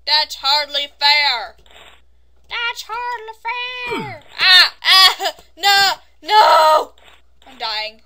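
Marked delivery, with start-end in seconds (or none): 0.1-1.6 slow, loud drawn-out protest; loud complaint. Real, not acted. Complains at the game. 2.5-4.2 slow, loud, very high wail; high whiny protest. Pretend. Repeats complaint in higher voice. 4.4-6.9 high, loud cries of protest; panicked shouting. Real, not acted. Dying in the game.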